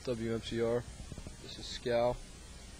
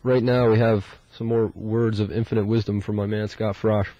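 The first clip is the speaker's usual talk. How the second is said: flat, droning monotone; deadpan monotone voice; 10 dB louder than usual. Shown as a demonstration. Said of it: "as I use my most monotone voice possible".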